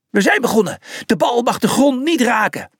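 high, projected announcement; referee calling out. Acted. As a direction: call the start across the field. Referee starts the match, calling out.

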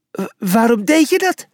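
high-pitched, stammering question; confused. A narrator's reading. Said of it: "Hij leek erg in de war".

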